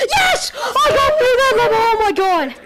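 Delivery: high, loud laughing cheer; triumphant shout. Real, not acted. Gamer cheering at finally beating the level, triumphant shout. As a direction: whoop with relief. Victory after hours.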